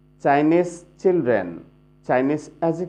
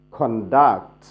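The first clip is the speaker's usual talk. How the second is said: "Conduct" with light "কন" and strong "duct"; stress on second syllable; 4 semitones lower, much slower than usual. Shown as a demonstration. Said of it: "অনুরূপভাবে". ("কন্ডাক্ট"-এর দ্বিতীয় syllable "ডাক্ট"-এ জোর)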